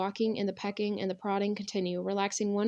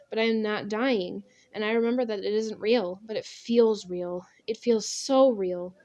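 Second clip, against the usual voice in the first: ordinary delivery, nothing marked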